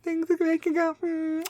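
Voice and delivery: high far-off singsong quote; playful high nineties catchphrase; song reference. Retold or imitated. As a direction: croon the catchphrase high and soft. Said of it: "what reference I just did".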